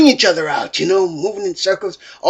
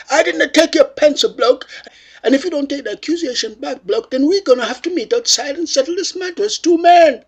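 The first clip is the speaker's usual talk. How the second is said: mock indignant challenge; 7 semitones higher than usual. Acted defiant reply in quarrel.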